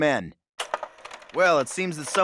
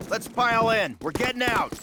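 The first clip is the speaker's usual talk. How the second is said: higher, louder hurried order; urgent call; 8 semitones higher, 3 dB louder than usual. Acted. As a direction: hurry the men into the truck. Urgent escape with the truck.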